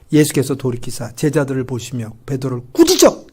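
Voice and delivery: ordinary talk, nothing marked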